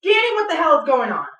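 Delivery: loud, high exasperated demand; exasperated yell. Acted. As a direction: demand answers, fed up. Demanding answer from Danny.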